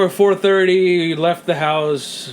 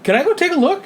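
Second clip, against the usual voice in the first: retold eager ask; 12 semitones higher, faster than usual. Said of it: "I'm like".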